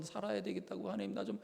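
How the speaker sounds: trembling plea. Retold or imitated. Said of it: "부들부들 떨면서".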